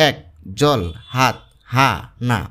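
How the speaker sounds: এক, জল, হাত, হা, না as isolated single beats; a clear pause after each of "এক, জল, হাত, হা, না"; one syllable each. Shown as a demonstration. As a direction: list "এক, জল, হাত, হা, না" one pulse at a time. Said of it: "একবারে উচ্চারণ হয় যা".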